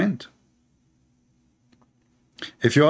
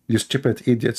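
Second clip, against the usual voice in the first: normal talking voice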